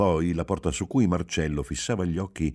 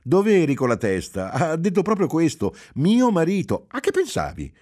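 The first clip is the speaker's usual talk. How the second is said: much higher and louder, insistent; incredulous wife; 12 semitones higher, 6 dB louder than usual. A narrator's reading. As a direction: press him, amazed he missed it. Wife scolds distracted husband. (moglie incredula, voce alta e insistente)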